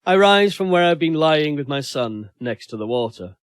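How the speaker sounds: plain reading voice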